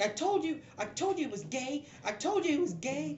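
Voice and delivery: very high put-on girl voice; indignant brothel girl. Game master's brothel girl saying I told you, high voice. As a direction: insist on it, high and exasperated. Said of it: "one of the brothel girls".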